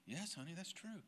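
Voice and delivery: soft mother's answer, gently voiced; gentle mom. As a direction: answer the child softly like his mother. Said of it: "she looked at me and she said".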